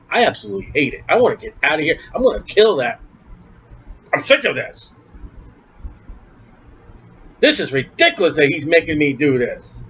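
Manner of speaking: low, soft sulky grumble; a mildly voiced flesh that hates the preaching; grumbling flesh. The preacher's flesh complains about preaching, in a sulky grumble. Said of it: "Flesh, Styler, how do you feel".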